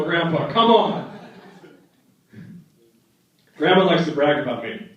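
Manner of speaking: usual speaking voice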